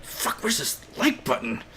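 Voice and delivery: hushed, slow mock shout; older viewer grumbling. Imagined older viewer at a desk hunting the button, mock shouting. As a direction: strain it out like a baffled older viewer. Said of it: "sitting at their desk shouting".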